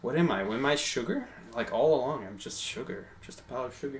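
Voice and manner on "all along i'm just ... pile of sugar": usual speaking voice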